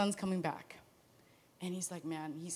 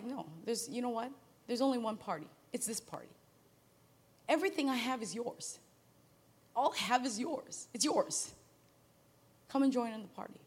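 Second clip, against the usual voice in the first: softer, gentle reassurance; tender father; 5 dB quieter than usual. Retold or imitated. Father gently reassuring his resentful older son, soft. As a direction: hush and reassure him lovingly. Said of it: "And Dad's like".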